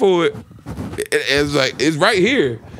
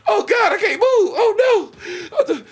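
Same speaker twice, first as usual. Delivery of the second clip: much higher, crying panicked pleading; mock freaking out; 6 semitones higher, slower than usual. Telling friends about his edible panic, hammed-up crying plea. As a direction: whimper and beg God in mock terror. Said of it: "I'm like".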